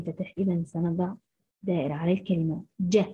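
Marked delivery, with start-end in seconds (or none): none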